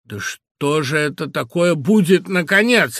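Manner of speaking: loud, rising, stern demand; menacing father. A narrator's reading. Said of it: "раздался за нами грозный голос". (грозно, громко)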